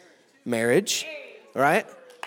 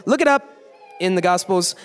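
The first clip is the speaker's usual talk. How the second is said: ordinary talk, nothing marked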